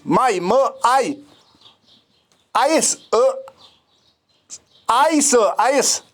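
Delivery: माई split into म and आई, then आइस stretched slowly; slow, syllable-by-syllable आइस for learners; sounding out diphthong. Shown as a demonstration. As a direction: break माई into म plus आई, and drag out आइस. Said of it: "so माइस".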